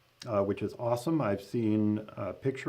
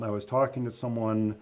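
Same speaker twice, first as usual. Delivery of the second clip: ordinary talk, nothing marked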